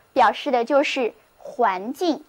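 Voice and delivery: normal talking voice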